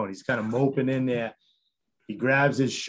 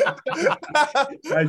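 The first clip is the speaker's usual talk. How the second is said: a squealing laugh, much higher and louder; high laughter; 8 dB louder than usual. Real, not acted. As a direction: crack up high and loud. Laughing at his own story.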